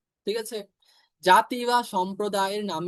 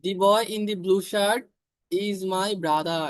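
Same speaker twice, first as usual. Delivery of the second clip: very slow, word by word; slowly reading the example sentence to students; slow dictation; much slower than usual. Shown as a demonstration. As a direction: dictate the whole sentence for the class to copy. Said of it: "নির্দিষ্ট করে বুঝালে".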